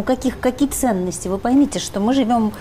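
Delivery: ordinary talk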